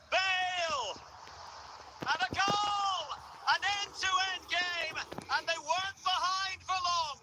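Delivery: high, drawn-out commentator's shout; excited goal call. Real, not acted. Commentator announcing an equalising goal to viewers, excited shout. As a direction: cry out the goal and sustain the high pitch.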